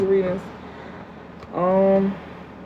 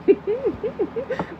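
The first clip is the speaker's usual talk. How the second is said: a squealing high laugh; high mocking laugh; 4 dB quieter than usual. Real, not acted. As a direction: cackle high at the commenter's spelling. Laughing at the commenter.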